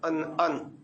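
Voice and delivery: ordinary talk, nothing marked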